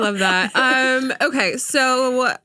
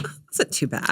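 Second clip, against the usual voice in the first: unvoiced, breathy whisper; whispered aside; 5 semitones lower, 7 dB quieter than usual. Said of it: "I was like".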